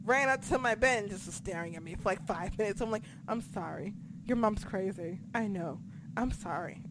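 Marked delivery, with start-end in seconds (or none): 3.3-6.8 soft, low murmur to a pet; hushed, low, acted-out apology; sheepish apology. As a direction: apologize quietly to the startled dog. Apologizing to her staring dog.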